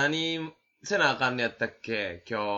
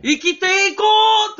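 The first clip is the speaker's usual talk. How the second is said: a sudden, much higher and louder cry; shouted resolve; 12 dB louder than usual. Real, not acted. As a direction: belt it out like a vow. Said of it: "あんだけ声張られたら". (叫ぶように力強く)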